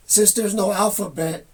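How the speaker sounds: ordinary delivery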